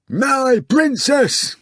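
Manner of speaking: high, slow, loud bawl in a strange voice. Acted. The hog bawling to Margarita, high and loud. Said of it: "the hog bawled loudly".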